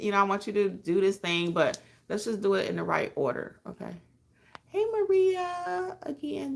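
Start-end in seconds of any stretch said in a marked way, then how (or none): 4.7-6.5 drawn-out greeting. Real, not acted.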